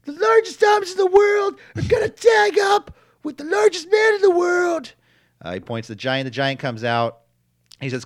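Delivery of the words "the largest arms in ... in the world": wrestling promo. Retold or imitated. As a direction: bellow it like a tongue-tied wrestler. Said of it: "he flubs his line and goes".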